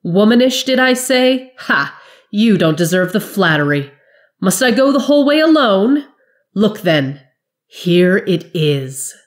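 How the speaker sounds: high, loud, sneering taunts; the captain taunting her frightened men; mocking scorn. A narrator's reading. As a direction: jeer at their cowardice. She flared at them.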